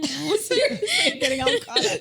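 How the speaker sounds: very high voice through laughter; real giggly, teary squeal; choked up laughing. Real, not acted. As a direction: laugh till you sound close to tears. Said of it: "think you're gonna cry now".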